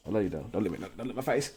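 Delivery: soft, laughing protest at the dog; fond dog talk. Real, not acted. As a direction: fend off a licking puppy. Dog licking his face.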